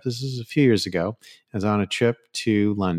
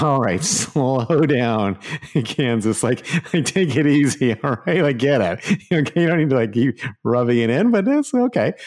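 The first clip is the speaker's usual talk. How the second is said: laughing protest; 4 semitones higher than usual. Retold or imitated. His inner reply, laughing.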